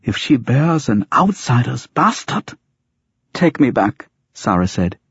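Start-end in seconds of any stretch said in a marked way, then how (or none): 0.0-2.5 high, pained character voice; distressed, higher-pitched objection. A narrator's reading. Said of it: "pain obvious". 3.3-4.1 higher-pitched woman's demand; firm, high character voice; insistent demand. A narrator's reading. Sari insists on returning.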